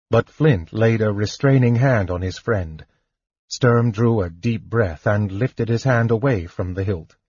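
usual speaking voice

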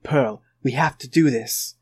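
low, slow, measured Garnet line; calm, grounded leader voice, slowed down; Garnet impression. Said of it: "that was a pretty good Garnet".

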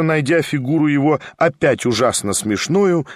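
ordinary delivery, nothing marked

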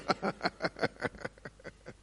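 high, soft wheezing laugh; real amused laughter, trailing off; breathy laugh. Real, not acted. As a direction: chuckle on, running out of air. Laughs after praising God.

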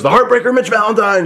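imitating an awed fan, pitched well up; hardcore fan. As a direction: hail the wrestler in a high mock fan voice. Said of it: "or he goes".

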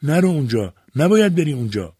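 high, loud cry to keep him out; shouted warning. A narrator's reading. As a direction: shout a desperate stop. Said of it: "ماریا داد زد". (با داد و هشدار)

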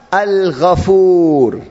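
"Al-Ghafur" very slow, long held final vowel; solemn emphasis. Shown as a demonstration. Preacher shows the intensive name of God, "Al-Ghafur" drawn out emphatically. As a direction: stretch out "Al-Ghafur" with weight.

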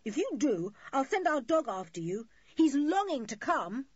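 loud, high warning; indignant cry. A narrator's reading. Julian warns the couple off with his dog, crying out. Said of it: "cried Julian".

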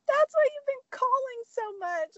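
very high, overcome mom imitation; tearful mom. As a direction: imitate mom piecing it together, voice way up. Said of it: "my mom just starts crying".

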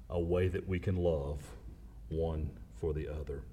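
low, soft, gentle invitation; soft intimate preaching. Acted. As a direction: draw them in quietly.

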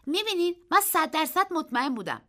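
put-on a boy bragging about his winnings; eager boy. A narrator's reading. Narrator voices the boy. (پسربچهٔ مشتاق با صدای زیر و بلند)

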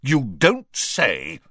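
seething, drawn-out reply; furious. A narrator's reading. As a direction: spell out each word in anger. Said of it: "said the Prime Minister furiously".

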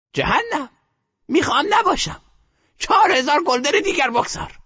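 put-on high defiant old woman; reckless grandmother. A narrator's reading. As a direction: throw caution away.

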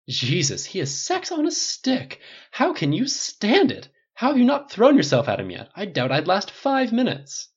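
high, slow, swooning camp voice; campy swooning. Acted. Stylist swooning at Tony over the captain, high campy. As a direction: melt over him theatrically. Jackie gushing, high swoops.

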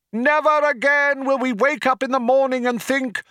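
rally the crowd over a tannoy; crying out to crowd. A narrator's reading. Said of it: "cried the man".

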